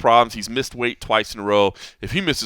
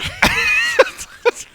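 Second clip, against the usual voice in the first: high cackle; 4 dB louder than usual. Real, not acted. Laughs at own voice.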